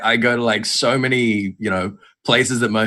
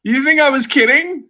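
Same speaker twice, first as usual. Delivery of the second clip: loud falsetto squawk of disbelief; mock outrage; 5 dB louder than usual. Host answering doubting contestant about hard questions, mock indignant squawk. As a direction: shoot way up high, loudly indignant.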